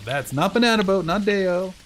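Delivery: excited denial. Real, not acted. Singing Banana Boat reference.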